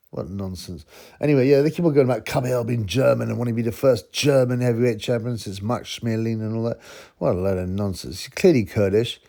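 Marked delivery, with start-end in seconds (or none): none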